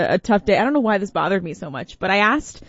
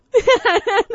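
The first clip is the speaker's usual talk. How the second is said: very high, short burst of laughter; 13 semitones higher, 3 dB louder than usual. Real, not acted. Host laughing at her olive meltdown, high laugh. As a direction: laugh at your own overreaction.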